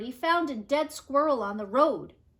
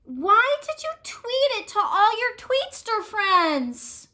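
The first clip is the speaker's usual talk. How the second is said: upset demand; 11 semitones higher, 7 dB louder than usual. Acted. Vulture confronts Birdie over photo.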